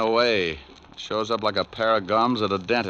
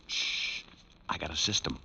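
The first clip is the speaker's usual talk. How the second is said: whisper; 7 semitones lower, 10 dB quieter than usual. Acted. Hushing, telling a secret.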